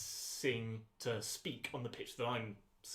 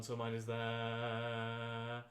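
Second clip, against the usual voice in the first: sing-song pitch; 2 semitones lower, much slower than usual. Shown as a demonstration. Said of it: "that sing-song is your natural speaking voice".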